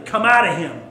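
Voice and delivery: a hint of a stern order; stern command. Jesus ordering the impure spirit out, sternly. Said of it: "said Jesus sternly".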